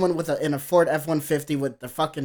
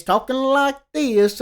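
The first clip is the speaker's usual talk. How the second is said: exaggerated southern drawl, higher and louder; mock southern accent; 7 semitones higher, 4 dB louder, slower than usual. Critic imitating a Canadian singer's affected accent, mock southern drawl. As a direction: put on a hammy southern twang. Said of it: "have a southern accent".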